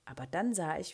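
ordinary delivery

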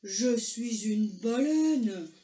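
very slow, booming whale; big whale voice. A narrator's reading. Whale reveals herself to Argento, slow and booming. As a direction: draw every word out hugely. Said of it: "la voix répondit".

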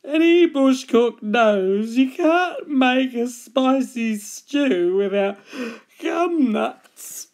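slow, drawn-out sleepy koala voice; yawning koala. A narrator's reading. Sleepy koala advising the cook, yawning. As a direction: drag the words out through yawns. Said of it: "he yawned".

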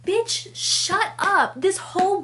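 high, slow, punched-out retort; exasperated telling-off. Real, not acted. Reader telling off a book character, exasperated. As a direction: draw out each word in outrage.

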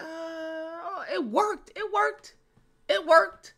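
high-pitched squeal of disbelief; squeaky, astonished mock reaction; amazed reaction. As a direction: act out being amazed, way up high. Acting out his amazed reaction.

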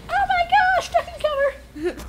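very high panicked squeal; panicky squeal. Retold or imitated. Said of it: "He's like".

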